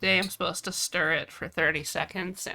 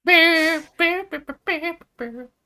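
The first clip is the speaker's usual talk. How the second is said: beeping timer imitation; 10 semitones higher, 5 dB louder, slower than usual.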